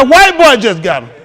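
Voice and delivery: loud, high, laughing call-out; laughing shout. Retold or imitated. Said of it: "I hollered loud to him".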